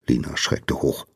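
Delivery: usual speaking voice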